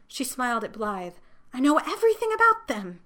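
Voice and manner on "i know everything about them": excited. Acted. Longing girl bursts out.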